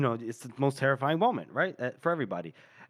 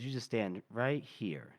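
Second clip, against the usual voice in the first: slow, soft, lower voice; fatherly reassurance; 4 semitones lower, 9 dB quieter, slower than usual. Acted. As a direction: gently guide a scared child. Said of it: "talking like a father to a scared child".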